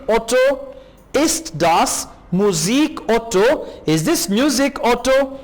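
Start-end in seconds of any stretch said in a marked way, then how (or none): 1.1-3.7 slow model reading. Shown as a demonstration. Slow German model phrase.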